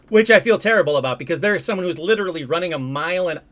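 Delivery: ordinary talk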